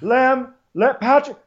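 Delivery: high calls, climbing with each try; hammed-up pestering for the waiter; [name]. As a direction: keep calling out, pitch mounting. Calling a waiter who ignores her.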